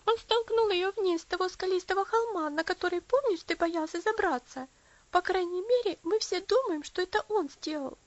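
high loud agitated telling; agitated child. A narrator's reading. Lucy tells her mother about Elsie, agitated, high and loud. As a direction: rush it out to mother.

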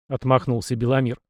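usual speaking voice

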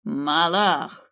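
a loud, ringing call; shouted summons. Acted. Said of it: "крикнул Давлат".

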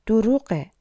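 mild narrated outburst of rage; angry shout. A narrator's reading. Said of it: "پترو با خشم فریاد زد". (فریاد خشمگین ملایم)

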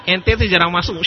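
loud, high, laughing retort; mock scolding lecturer. Lecturer scolds absent student in pistol joke, mock scolding. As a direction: playfully tell off a student through laughter.

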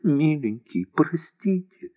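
tearful whispered plea. A narrator's reading. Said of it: "пухлыми детскими губами шептала".

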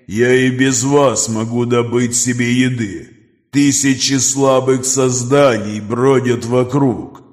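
slow, distant predatory creature; monster voice. Acted. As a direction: speak like a cold ancient beast. Creature Nokris speaks.

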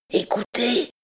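high, urgent appeal; urgent cry. A narrator's reading. Hunchback tries to overcome the captain's hesitation, crying out. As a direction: cry out to win him over. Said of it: "s'écria Quasimodo".

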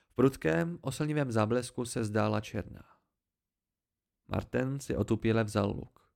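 usual speaking voice

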